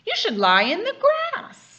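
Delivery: high, loud lion voice; a lazy lion calling out high. A narrator's reading. Father reading to child, high loud lion voice. Lion line read aloud.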